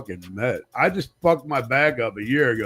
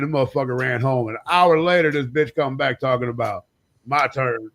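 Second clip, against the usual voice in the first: ordinary talk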